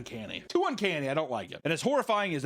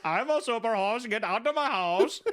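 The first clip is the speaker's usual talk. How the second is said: higher, pompous put-on host voice; mock host; 6 semitones higher than usual. Host kicking guests out, hammed-up pompous voice. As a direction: act the smug super host. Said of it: "host voice".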